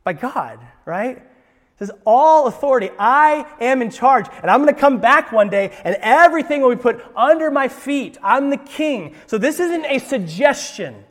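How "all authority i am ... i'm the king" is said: commanding king. Acted. Jesus voiced as commanding king.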